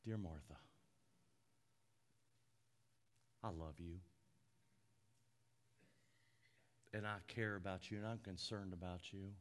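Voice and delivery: Jesus lovingly calming an anxious Martha; tender Jesus. Acted. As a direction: reassure her gently, almost a whisper. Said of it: "He didn't. He said".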